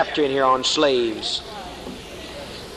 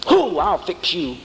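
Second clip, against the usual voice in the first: mock angry prophet; slower than usual.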